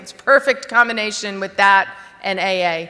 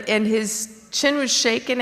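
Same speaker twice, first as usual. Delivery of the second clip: usual speaking voice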